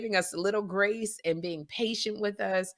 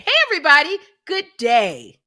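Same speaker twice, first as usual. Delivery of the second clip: loud bright announcer greeting; newscaster voice; 7 semitones higher, 13 dB louder than usual. Shown as a demonstration. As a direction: boom it out like a TV anchor. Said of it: "but I'll try it".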